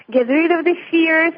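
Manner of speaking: ordinary talk, nothing marked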